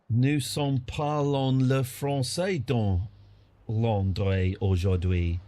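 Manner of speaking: slow, hammed-up French accent; mock French accent. Player goofing in a slow mock French accent.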